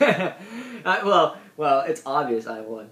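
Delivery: ordinary delivery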